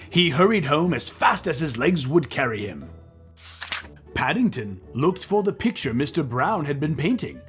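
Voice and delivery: usual speaking voice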